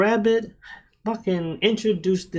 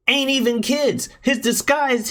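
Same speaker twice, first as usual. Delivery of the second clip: ordinary talk, nothing marked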